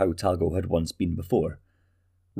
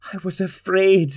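much higher, tearful cry; weeping; 11 semitones higher, 5 dB louder than usual. Acted. Talgo's mother confesses her fear, weeping and much higher. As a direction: confess it through tears. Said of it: "his mother wept".

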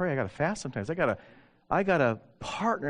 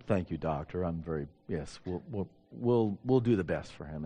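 polite dismissive; 8 semitones lower, 6 dB quieter than usual. Retold or imitated. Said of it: "I said".

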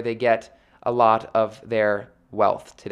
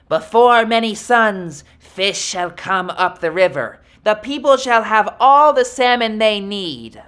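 higher, louder angry Coyote vow; 8 semitones higher, 6 dB louder than usual. Acted. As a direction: proclaim it in fury as Coyote. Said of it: "Coyote was very angry".

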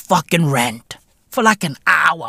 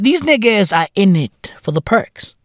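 usual speaking voice

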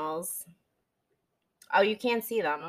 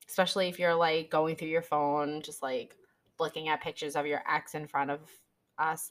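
ordinary delivery

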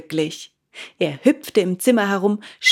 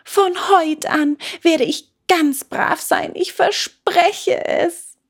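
put-on high child's voice, lightly tearful; sobbing promise; 10 semitones higher than usual. A narrator's reading. Puppet promising father to be good, mildly tearful. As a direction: promise it through mild sobs, slowing at the end. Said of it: "schluchzte Bengele".

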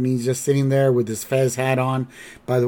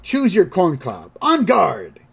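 higher, louder duelling challenge; mock swashbuckling call to arms; Bart; 5 semitones higher, 4 dB louder than usual. Bart starting a dinner table duel, challenging. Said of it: "And then Bart's like".

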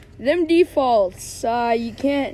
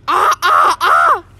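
loud, higher squawking "ah"; bird-like squawk; 4 semitones higher, 10 dB louder, slower than usual. Acted. Boy playing Johnny opens wide with a loud birdlike squawk. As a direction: caw it out like a startled bird. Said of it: "I sounded like a bird".

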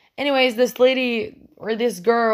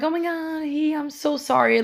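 higher-pitched imitation of a flustered apology; embarrassed apology; 6 semitones higher than usual. Dog owner to a passenger after her dog jumps up, imitated embarrassment. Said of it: "like, she was embarrassed".

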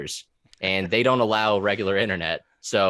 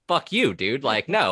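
higher, louder mock angry retort; imitating his own heated reply, raised and loud; retold angry comeback; 6 semitones higher, 3 dB louder than usual. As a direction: fire it back like you're offended, replaying it. Retelling angry retort to poster.

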